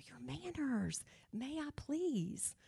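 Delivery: quiet prompt. Retold or imitated. Prompting boy's manners at dinner.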